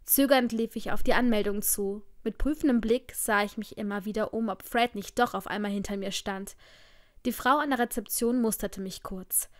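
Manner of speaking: plain reading voice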